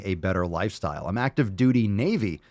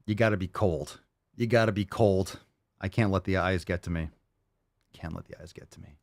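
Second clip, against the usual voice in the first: usual speaking voice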